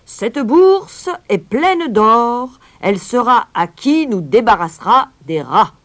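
loud, high, drawn-out announcement; proclaiming shout. A narrator's reading. Mayor offers the purse to the crowd, crying out. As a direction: proclaim it slowly to the whole square. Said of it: "cria en la brandissant".

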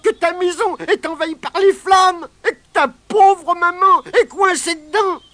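very high, loud mock-sobbing goblin; fake sobbing leprechaun. Leprechaun pretends to cry to trick Pat, very high hammed-up sobs. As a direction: fake tears to trick him. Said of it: "fit mine de sangloter".

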